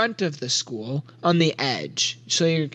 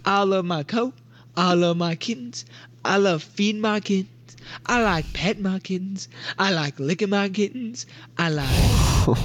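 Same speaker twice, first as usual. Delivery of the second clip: Big Tim; 3 semitones higher than usual. Acted. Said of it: "He goes".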